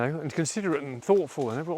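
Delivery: ordinary talk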